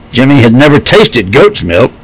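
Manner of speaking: ordinary delivery, nothing marked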